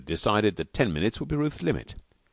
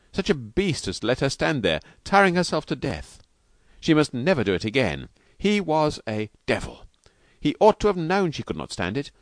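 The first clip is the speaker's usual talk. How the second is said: mounting self-reproach, voice climbing higher; 7 semitones higher than usual. A narrator's reading. Husband cursing himself beside his wife, building self-reproach. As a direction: build steadily into higher-pitched self-blame. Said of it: "burst into a babble of self-reproach".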